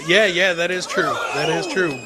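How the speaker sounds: ordinary delivery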